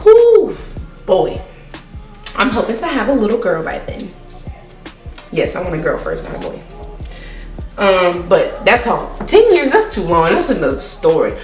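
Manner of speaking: ordinary talk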